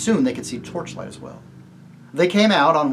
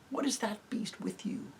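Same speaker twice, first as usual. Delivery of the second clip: whispered question; 8 semitones higher, 12 dB quieter than usual. Acted. Whispering in the dark.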